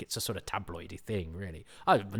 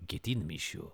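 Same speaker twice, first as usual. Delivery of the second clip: attempted French accent; 4 semitones lower, 5 dB quieter than usual. Shown as a demonstration. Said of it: "what's he supposed to do?".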